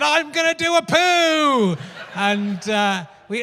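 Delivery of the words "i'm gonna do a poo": put-on high, slow childlike voice; three-year-old son. Son announcing a poo in the garden, high slow child voice. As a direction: draw it out like a proud toddler. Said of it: "And then he said".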